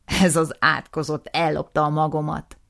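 loud, furious outburst; angry accusation. Acted. Nisim accusing his brother, loud and furious. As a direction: spit out the accusation.

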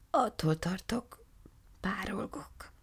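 faint, high whisper of a fading cloud; barely audible. Acted. Shrinking cloud answers Margaréta in a faint whisper. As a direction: breathe it out as if evaporating. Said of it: "felelte alig hallhatóan".

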